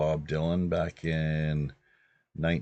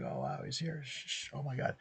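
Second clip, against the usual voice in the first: softer and higher, breathless hush; hushed starstruck gasp; 9 semitones higher, 12 dB quieter than usual. Retold or imitated. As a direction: hush everyone, he's right there. Fans hushing as star arrives.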